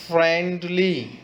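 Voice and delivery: Friendly slowly in two beats, friend then ली; split syllables. Shown as a demonstration. Teacher showing kids syllables, friendly split slowly as फ्रेंड-ली. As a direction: stretch friendly, parting friend from ली. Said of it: "अलग-अलग टू syllable".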